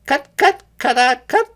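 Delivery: high, loud clucking hen; cackling hens. A narrator's reading.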